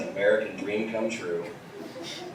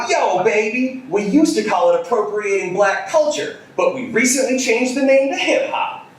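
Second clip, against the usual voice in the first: mock hip-hop poser; 11 semitones higher, 3 dB louder than usual. Poser voiced in story.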